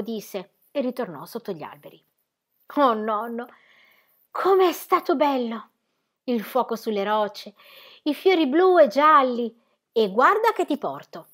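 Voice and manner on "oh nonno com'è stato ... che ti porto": a hint of an eager child, a bit higher; lightly voiced child full of wonder; lightly voiced little girl. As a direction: marvel like a little girl at pink mountains. Narrator voices child Heidi.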